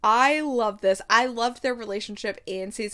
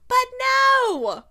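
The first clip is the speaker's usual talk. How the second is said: much higher, louder wail of protest; frustrated cry; 13 semitones higher, 9 dB louder than usual. Real, not acted. Vlogger wailing at the missed proposal, frustrated. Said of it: "loud frustrated cry".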